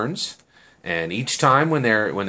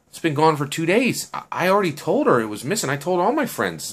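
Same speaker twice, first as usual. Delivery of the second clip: higher-pitched retold complaint; exasperated protest; 9 semitones higher than usual. Girl explaining her phone has been missing, exasperated. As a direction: protest as the worried phone owner.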